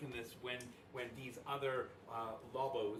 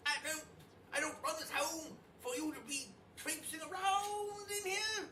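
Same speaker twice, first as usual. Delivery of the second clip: high, fussy French chef voice; 5 dB louder than usual. Acted. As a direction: scold like a haughty French chef. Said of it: "Archibald is, is, uh, says".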